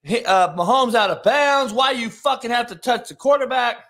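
loud, exasperated mock rant; angry teammate. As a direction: imitate a player chewing out a teammate. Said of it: "came out and was like".

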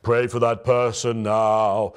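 slow, booming voice from heaven; big spooky voice. Pretend. Preacher mocking the idea of a booming heavenly voice, slow and spooky. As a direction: intone it like a slow voice from the sky. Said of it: "a big spooky voice".

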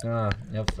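ordinary talk, nothing marked

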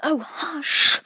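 high, urgent shush; scared, insistent hush; frightened hushing. A narrator's reading. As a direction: shush in alarm, drawing out the hiss. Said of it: "whispered in a frightened tone".

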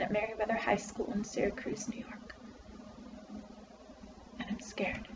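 put-on soft, withdrawn teenage outcast; hushed, downcast confession with halting pauses; scared, friendless freshman. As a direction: confide it very quietly, as if afraid to be heard. Plays scared, friendless Melinda in monologue.

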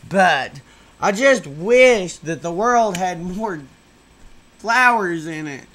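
a try at saying it with real vigor; with emphasis. Shown as a demonstration. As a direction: push every word out with effort. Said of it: "I wish I could say it with more emphasis".

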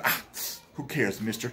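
a hint of a mocking Nigerian accent. Wife dismissing husband's demand, mild mocking Nigerian accent. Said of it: "Like that. Like a Nigerian, uh, Nollywood".

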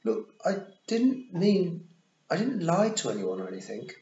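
put-on high, loud boy blurting it out; flustered boyish outburst; blurted apology. A narrator's reading. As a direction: burst out with a nervous excuse. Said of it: "he blurted out".

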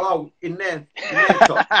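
chuckling as he delivers the punchline; laughing through it. Retold or imitated. Telling his mate the joke's punchline, laughing through it. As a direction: let a laugh break into the words.

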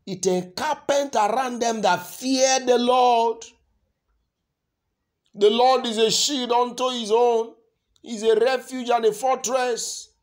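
loud, high pulpit proclamation; ringing, declaimed sermon; full-force preaching. Real, not acted. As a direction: proclaim the scripture at the top of your voice.

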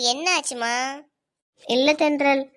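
usual speaking voice

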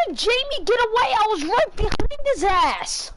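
high-pitched, incredulous protest; exasperated disbelief. Real, not acted. Streamer baffled that Jamie got away, exasperated disbelief.

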